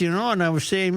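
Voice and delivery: ordinary delivery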